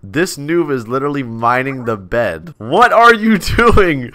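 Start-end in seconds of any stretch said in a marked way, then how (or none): 2.6-4.1 asks through a laugh, high and loud; can't hold back the laugh mid-question. Real, not acted. Laughing at the noob mining his own bed.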